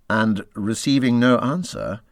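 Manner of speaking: usual speaking voice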